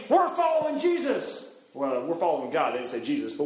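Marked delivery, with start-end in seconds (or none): 0.1-1.5 high-pitched rallying cry; enthusiastic declaration. Acted. Israelites in the wilderness declaring loyalty, enthusiastic. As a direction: proclaim it eagerly, pitched high. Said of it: "One minute they're saying".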